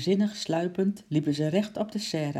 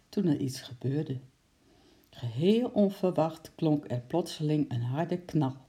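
ordinary delivery

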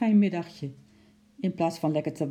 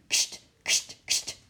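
sharp hissed kst to chase off an animal; shooing noise; slower than usual. A narrator's reading. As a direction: hiss at the monkey to shoo it.

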